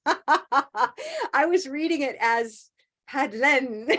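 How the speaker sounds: giggling through the misread name; laughing. Real, not acted. Teacher laughing at misreading the name. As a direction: laugh at her own mistake. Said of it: "Helen, there we go".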